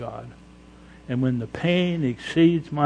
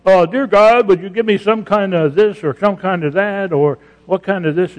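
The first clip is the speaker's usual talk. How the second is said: mock lazy prayer; 5 semitones higher, 6 dB louder than usual. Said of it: "He hates prayers like".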